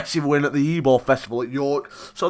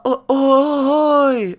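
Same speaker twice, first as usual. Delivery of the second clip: higher, louder drawn "Oi, oi, oi"; imitating catchphrase; 11 semitones higher, 5 dB louder than usual. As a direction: copy the lad's call. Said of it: "It started off as that, like".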